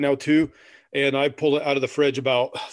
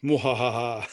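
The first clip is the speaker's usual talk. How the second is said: sneering panto villain; 3 semitones lower, slower than usual. Pretend. Gloating over opening second beer.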